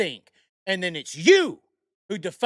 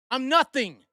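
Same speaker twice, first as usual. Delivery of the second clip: usual speaking voice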